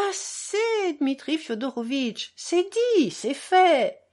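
the benefactress settles it triumphantly; a touch of triumphant finality; decisive. Acted. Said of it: "trancha madame Koklakov".

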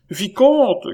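high, loud alarmed call; cried out. A narrator's reading. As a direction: call him back urgently, voice pitched high. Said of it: "criait la voix de Chon". (cri aigu et pressant)

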